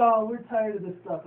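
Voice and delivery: high, loud whiny complaint; hammed-up fed-up pony; fed-up filly. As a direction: whine it like an exasperated kid. Ponies fed up in scene.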